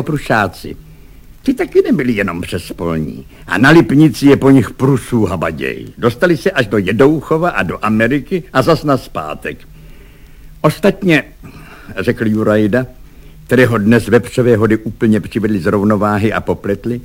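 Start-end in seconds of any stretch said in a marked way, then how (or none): none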